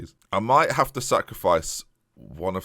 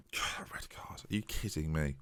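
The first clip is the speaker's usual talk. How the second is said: breathless, dismayed near-whisper; stunned hush; 5 semitones lower, 13 dB quieter than usual. Real, not acted. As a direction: gasp it out in disbelief. Red card given.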